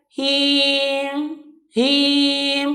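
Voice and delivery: him, m swallowed softly; unclear mim. Shown as a demonstration. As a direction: him trailing quietly. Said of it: "huruf mimnya tidak jelas". (him dengan mim samar)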